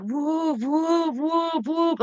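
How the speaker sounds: high, overlapping-style run of whoops; imitated whoops. Describing many calls sounding at once, high imitated whooping. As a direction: chain long high whoops together. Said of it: "They were just like".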